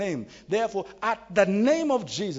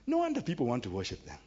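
normal talking voice

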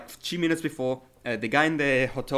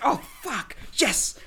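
sudden high yelp of joy; mock fan cheer, pitch leaping up; excited cheer; 9 semitones higher, slower than usual. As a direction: cheer the shot like you're in the stands. Cheering a basketball shot.

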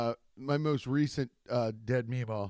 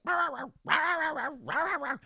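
high, rapid yipping bark; yappy little dog; 13 semitones higher than usual. Retold or imitated. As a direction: yap like a tiny lapdog, way up high.

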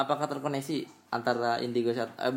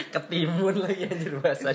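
higher-pitched words through a laugh; 6 semitones higher, 3 dB louder than usual. Real, not acted. Host tickled by co-host's cucumber joke, laughing. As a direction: laugh as you repeat it.

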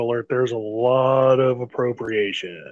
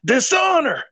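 high, loud theatrical shouting of dishonor; mock dramatic rant; 9 semitones higher, 5 dB louder than usual. Podcast host quoting a cartoon curse at his cohost, hammed-up shout. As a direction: ham up the cartoon dragon's outrage.

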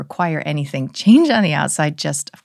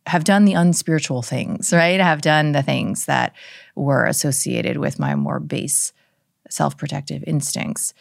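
usual speaking voice

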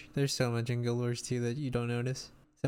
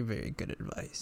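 breathy whisper, trailing off; 7 dB quieter than usual. Real, not acted. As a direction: whisper it as an aside. Whispered echo.

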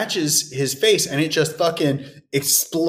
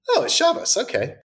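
mock realization; 9 semitones higher than usual. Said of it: "And I was like".

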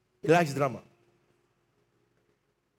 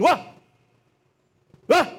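mock bark; 9 semitones higher, 6 dB louder than usual. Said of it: "you keep barking".

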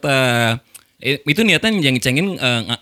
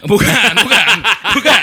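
higher and louder, laughing through it; laughing no to a friend's accusation; laughing denial; 9 semitones higher, 6 dB louder than usual. Real, not acted. Denying while friends tease.

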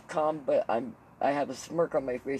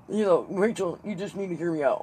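pleading dad; 6 semitones higher, 5 dB louder than usual. Retold or imitated. Playing dad pitching idea to kid.

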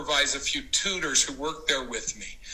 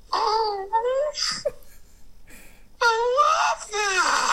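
high, drawn-out swooning gush; a much higher, slow sobbing swoon of delight; gushing bride; much slower than usual. Retold or imitated. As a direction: overcome bride's teary gush. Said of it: "And she went".

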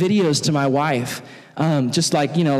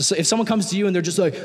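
ordinary talk

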